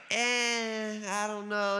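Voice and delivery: drawn-out, doubtful "eh"; mock lukewarm reaction; unimpressed shrug. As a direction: sound unconvinced, with a long hesitant noise. Acting out doubtful reaction to donkey.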